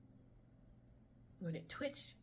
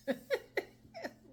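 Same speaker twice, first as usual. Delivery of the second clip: laugh. Real, not acted. Laugh after sung line.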